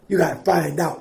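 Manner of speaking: normal talking voice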